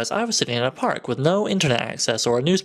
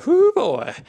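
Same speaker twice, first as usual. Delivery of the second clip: much higher, louder whoop of self-congratulation; excited whoop; 4 dB louder than usual. Real, not acted. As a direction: cheer for yourself. Celebrating his twelve points.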